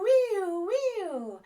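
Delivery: "wee-oo" way up high and loud like a siren. Shown as a demonstration. Therapist modelling a fire truck siren for kids. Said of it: "a fire truck might be wee-oo".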